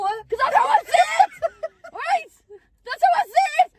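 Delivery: high, loud, defensive insistence; high-pitched protest. Real, not acted. Host defending her Newcastle accent, high-pitched protest. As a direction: plead her case for how she talks.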